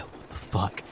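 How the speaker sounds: breathless, whispered disbelief; stunned whisper. Retold or imitated. A frozen kid murmuring in shock. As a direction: whisper it, dazed. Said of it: "And I was like".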